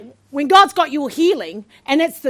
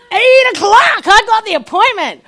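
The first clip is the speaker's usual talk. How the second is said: loud, high-pitched indignant retelling; fuming; 7 semitones higher, 7 dB louder than usual. Retold or imitated. Patient fuming over a late appointment, indignant. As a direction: act out outrage at the wait. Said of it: "I'm fuming".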